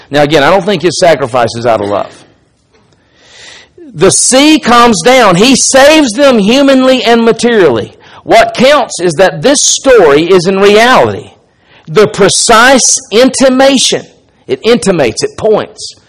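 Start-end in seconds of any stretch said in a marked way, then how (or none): 3.8-14.0 raised pulpit voice, stretching the key words; preacher reading a quotation. Acted. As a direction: read it out weightily to a congregation. Reads a quotation aloud to congregation.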